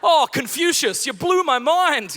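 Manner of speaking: high, loud, slow mock amazement; hammed-up sarcastic wonder; sarcastic awe. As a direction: sneer as if dazzled. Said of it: "that's what I said".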